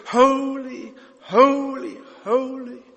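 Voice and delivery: high, sustained, evenly repeated cry; held notes of reverent praise; worshipful acclamation. Acted. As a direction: intone each word like a heavenly choir. Voices heaven's creatures worshipping God.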